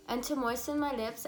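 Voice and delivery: usual speaking voice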